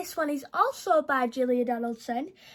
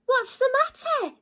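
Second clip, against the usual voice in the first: concerned giant; 12 semitones higher, 4 dB louder than usual. Acted. George asks the goat.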